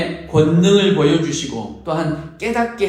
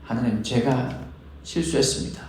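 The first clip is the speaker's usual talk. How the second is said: softer, falling, simply owning the mistake; humble confession; 5 semitones lower, 8 dB quieter than usual. Retold or imitated. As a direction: admit it quietly before God. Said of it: "라는 고백인 거죠". (조용하고 겸손한 고백)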